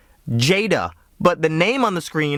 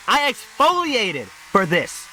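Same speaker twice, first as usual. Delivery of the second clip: high, slow, stretched-out complaint; hammed-up affronted grievance; mock indignation; 7 semitones higher, slower than usual. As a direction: lean hard on "exfoliated", falling at the end. Repeats mock outrage driving home.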